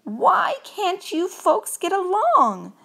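high, loud, drawn-out plea; exasperated cry. A narrator's reading. Gus pleads between Mouse and the Scotts, exasperated cry. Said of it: "cried Gus".

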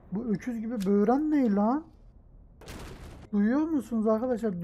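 usual speaking voice

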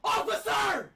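high, urgent cry for help; mock panicked shout. Prank caller to police officer, mock panicked shout. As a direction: fake a panicked call out.

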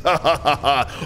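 villain laugh. Acted.

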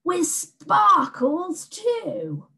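high, very slow, gleeful monster voice; the monster thrilled by sparkles; delighted beast. A narrator's reading.

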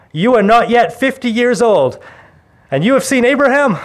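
high, loud scoffing disbelief; incredulous crowd. Acted. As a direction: scoff at the claim like a doubting crowd.

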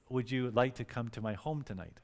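softly voiced shy invitation; imitating a quiet, shy man. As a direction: ask it gently, well under normal volume. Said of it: "very quietly".

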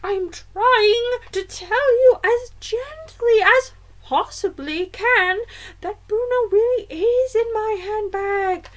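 high, loud, slowly stressed grandmother voice; insistent grandmother. A narrator's reading. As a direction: spell it out slowly, losing patience.

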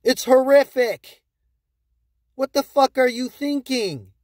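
appalled at what they're doing; exasperated outburst. Real, not acted. Berating stubborn investors, loud disbelief. Ranting at chart collapse.

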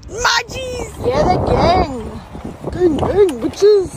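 loud shouted chant to the gang; rowdy group cheer. Real, not acted. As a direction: call the crew out, hyped up. Cheering the group while riding.

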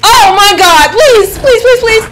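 high, loud desperate begging; panicked pleading. Real, not acted. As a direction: plead frantically for it to stop. Begging while fleeing spider.

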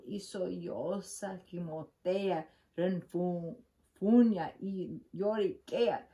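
ordinary delivery, nothing marked

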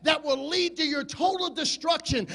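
building to a roaring shout; full-force preaching. Acted.

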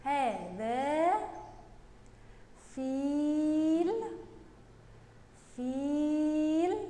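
very slow, stretched vowels; slow read-along. Shown as a demonstration. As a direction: hold the long vowels for pupils to repeat. Teacher leads pupils reading together. (قراءة بطيئة بمدّ الحروف)

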